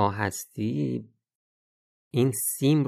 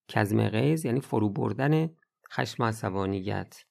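ordinary talk

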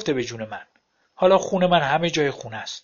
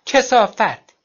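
کثافت yelled, higher and louder; shouted curse; 7 semitones higher, 7 dB louder, much slower than usual. A narrator's reading. Ex-wife's last insult, کثافت screamed at him. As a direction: spit out کثافت at full shout.

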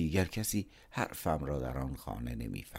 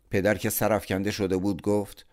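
ordinary delivery

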